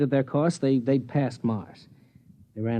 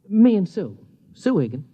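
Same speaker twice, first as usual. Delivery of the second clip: caught off guard; 5 semitones higher, 6 dB louder than usual. Acted. Caught mentioning another woman.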